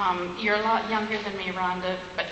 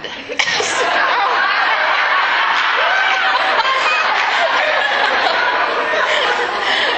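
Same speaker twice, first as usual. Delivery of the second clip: big loud laugh; 10 dB louder than usual. Real, not acted. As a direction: burst out in a long high loud laugh. Joke about matching tote bags.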